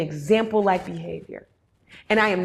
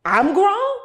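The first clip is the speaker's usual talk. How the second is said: high, loud, rising "I'm grown?"; a young adult caught off guard, incredulous; surprised disbelief; 9 semitones higher, 5 dB louder than usual. Retold or imitated. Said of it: "you were like".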